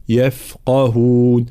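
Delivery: "يفقهون" slowly as "yef-qahun", f drawn out; thin "يف", heavy qaf in "يفقهون"; light ya, flowing fa. Shown as a demonstration. Teacher giving the correct form, "يفقهون" with light ya. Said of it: "هكذا".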